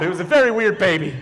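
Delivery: usual speaking voice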